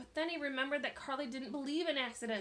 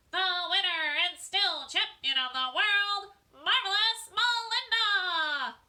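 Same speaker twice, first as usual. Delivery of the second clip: slow, higher, louder ringside announcer bellow; 9 semitones higher, 11 dB louder, slower than usual. A narrator's reading. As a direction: announce the champion like a boxing ring. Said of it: "She bellowed".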